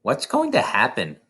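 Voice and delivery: plain reading voice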